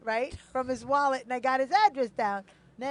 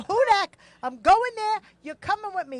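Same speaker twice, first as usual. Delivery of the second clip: higher, louder re-enacted order; retold insistent demand; 7 semitones higher, 7 dB louder than usual. Woman ordering Hudak to come along, insistent.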